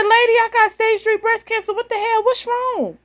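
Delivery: high, loud mock outrage; retold exasperated outburst. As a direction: replay your own disbelief, voice up high.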